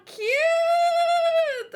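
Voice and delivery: high sung squeal of cute. Real, not acted. Fan squealing over a cute panel, high and sung. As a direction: squeal it like a fan seeing something adorable.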